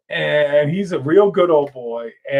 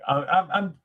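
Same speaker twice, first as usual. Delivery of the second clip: normal talking voice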